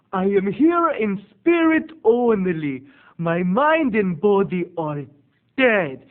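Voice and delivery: slow, loud, theatrical proclamation; solemn hammed-up impression with drawn-out words; imitated eccentric aunt. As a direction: stretch each word like a ghostly, morbid aunt. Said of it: "she talks like this".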